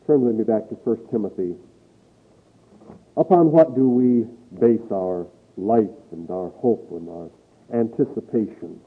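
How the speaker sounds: normal talking voice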